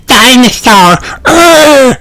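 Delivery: high mock roar from a small boy; little dinosaur roar. A narrator's reading. George greeting Grandpa as a dinosaur, high playful roar. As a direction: roar it out like a toddler playing dinosaur. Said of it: "said George, roaring".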